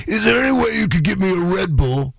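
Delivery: imitating [name]. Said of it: "He's like".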